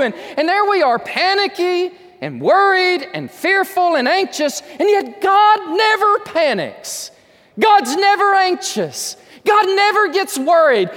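high, loud, slow preaching peak; impassioned sermon climax; preaching at full force. Acted. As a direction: proclaim it from the pulpit.

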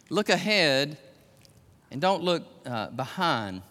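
ordinary delivery

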